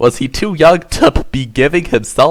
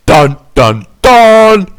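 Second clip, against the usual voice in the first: loud sung sting, last note jumping high; dramatic dun dun dun; 8 semitones higher, 8 dB louder, much slower than usual. Acted. Narrator hits the big reveal with a loud sung sting. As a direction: belt a cheesy dramatic sting.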